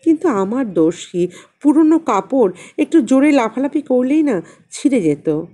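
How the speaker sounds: plain reading voice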